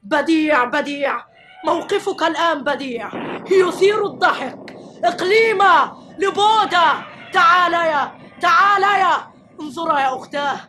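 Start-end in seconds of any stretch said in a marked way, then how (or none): 5.0-9.3 loud high calls to the sisters; calling out. Acted. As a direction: call them over, loud and high. (ينادي أختيه بصوت عالٍ)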